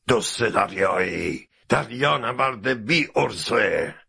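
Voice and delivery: slow, scornful captain's rage; angry insult. A narrator's reading. Captain insulting Mr. Fogg, angry and drawn out. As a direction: spit the insults out slowly, angrily. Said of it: "ناخدا از عصبانیت سرخ شده بود".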